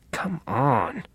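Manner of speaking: grumbling. A narrator's reading. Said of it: "he grumbled".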